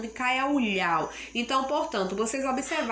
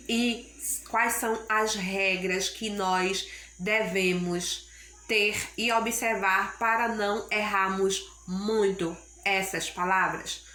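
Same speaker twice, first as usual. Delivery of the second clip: usual speaking voice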